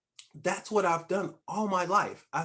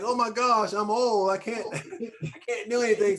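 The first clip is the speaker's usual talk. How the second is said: higher, louder mock panic; mock freak-out; 5 semitones higher, 3 dB louder than usual. Host imitating people who turn forty, mock freak-out. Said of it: "they be freaking out like".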